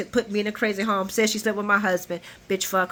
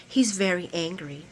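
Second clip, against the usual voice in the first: softly downplayed, as if over burnt biscuits; mock-mild little admission; understated imitation; 2 semitones lower, 5 dB quieter than usual. Imitating Nina explaining his anger, mildly understated. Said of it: "like she burnt the biscuits".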